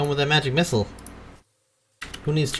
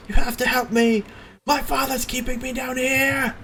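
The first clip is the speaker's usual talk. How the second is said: high, strained pleading cry; desperate trapped son. Acted. Captive son begging adventurers for help, desperate high cry. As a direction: plead in a much higher, put-on voice.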